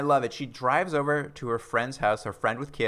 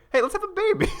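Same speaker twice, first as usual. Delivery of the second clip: very high, perky mock suggestion; imitated wife in a squeaky-high voice; mock eager wife; 4 dB louder, much faster than usual. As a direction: pitch it way up, absurdly eager. Mocking wife's sudden baby proposal.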